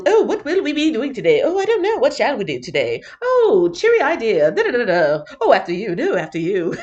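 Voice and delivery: prim cartoon gopher in a posh English accent. Teacher imitates the Goofy Gophers for her class, posh English accent. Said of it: "these little English accents".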